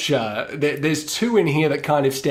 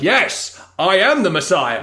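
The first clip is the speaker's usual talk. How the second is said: louder, high proclamation; grand declaration; 5 semitones higher, 3 dB louder than usual. Retold or imitated. Kid answers the roll call, grandly. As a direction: proclaim it like a prophet.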